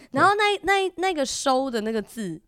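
usual speaking voice

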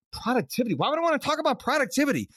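retold disbelief, pitched high and quick; mock astonished question; incredulous. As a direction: echo the word back like it's absurd. Retold disbelief at coach's suggestion.

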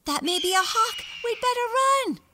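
high, loud squeaky warning; scared. Acted. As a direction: urge them to flee, panicked. Scared mouse urges fleeing.

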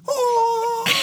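very high, loud drawn-out wail; mock scream. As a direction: imitate a bad actress screaming. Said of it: "She goes".

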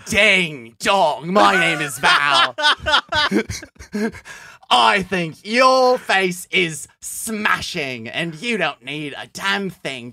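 high, loud hammed-up Australian accent. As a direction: play Val with a big Australian accent. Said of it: "That's kind of Australian".

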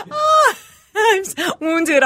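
high laughing yelp; laughing shriek. Real, not acted. Cracking up at the letter's sign-off. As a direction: burst out laughing.

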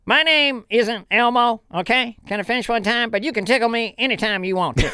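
Ross Perot impression. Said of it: "Ross Perot".

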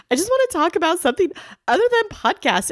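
retold agitated outburst, high and rushed. Wife retelling her outburst at podcast talk, agitated. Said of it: "I was getting really agitated".

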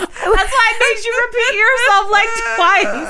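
high, loud laughing talk. Real, not acted. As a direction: laugh through the words. Laughing through a story.

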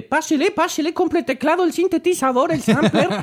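high singsong street vendor; singsong market price calls. Pretend. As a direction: call out to shoppers like a market seller. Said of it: "meterme a algún mercado".

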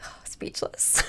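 voiceless awed whisper; starstruck whisper. Retold or imitated. Fan retelling a politician's handshake, whispered. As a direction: whisper it as if struck speechless. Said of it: "and I was like".

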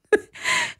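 squeaky high burst of laughter. Real, not acted. Finishing the bagel mix-up story, high laugh. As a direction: laugh at how absurd it was.

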